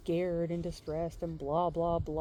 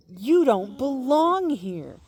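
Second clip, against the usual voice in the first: loud, slow, higher insistence; hostile woman repeating; 6 semitones higher, 11 dB louder, slower than usual. Retold or imitated. Stranger repeating herself to the kid, loud and slow. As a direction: say it again, louder and colder.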